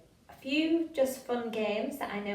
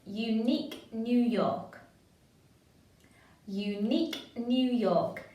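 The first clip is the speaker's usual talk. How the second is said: slow, every word of "Unique New York" shaped and stressed; slow emphasised tongue twister; slower than usual. Shown as a demonstration. Workshop leader shows the class how to warm up, slow and emphatic. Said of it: "Really emphasise the words in your mouth".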